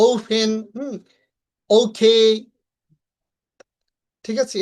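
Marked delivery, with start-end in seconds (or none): 0.1-2.2 ওপেন as "ou-phen", ওকে as "ou-khei"; careful model of ওপেন and ওকে, aspirated. Shown as a demonstration. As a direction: puff of air inside ওপেন and ওকে. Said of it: "এরকম ভাবে উচ্চারণ করব".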